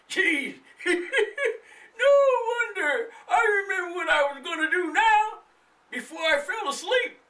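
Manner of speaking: put-on high, chuckling old man; old man laughing. The old man laughing about the burned-out bulb, high chuckle. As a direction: laugh at your own forgetfulness.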